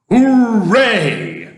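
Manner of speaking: loud, high, drawn-out cheer; shouting. A narrator's reading. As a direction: cheer at the top of your voice. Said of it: "shouted the old green grasshopper".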